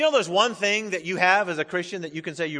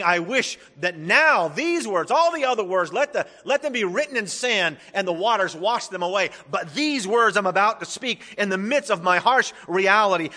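raised, higher voice, urgent proclamation; emphatic shouting; 4 semitones higher, 5 dB louder than usual. Acted. Preacher voices Job crying out to his friends, shouted emphatically. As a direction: proclaim it forcefully, easing at the end. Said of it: "Notice that he's shouting".

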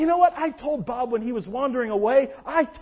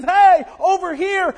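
loud slow call to draw the wolf; shepherd shouting; 3 semitones higher, 7 dB louder, slower than usual. Retold or imitated. Shepherd shouts at the wolf to save the sheep, loud. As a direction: bellow it out slowly. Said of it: "I hear the shepherd shouting".

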